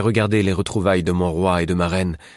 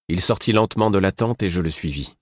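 ordinary delivery

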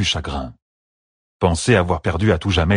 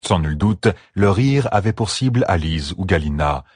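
ordinary delivery, nothing marked

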